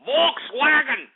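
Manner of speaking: high-pitched mock German accent, slowing on Volkswagen; a joking German accent aimed at German listeners. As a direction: bark out fake German words in a hammed-up German accent. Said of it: "for our German brethren".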